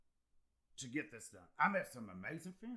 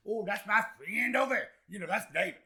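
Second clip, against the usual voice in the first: high, loud imitation of eager hangers-on; mock boasting; 7 semitones higher, 8 dB louder than usual. Former doubters claiming him as their friend, mock boasting. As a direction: crow it proudly, high and loud, mimicking them.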